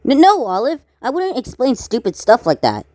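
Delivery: high flustered objection; flustered denial. Acted. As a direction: stutter out the denial, embarrassed. Tan embarrassed by Olive's tease.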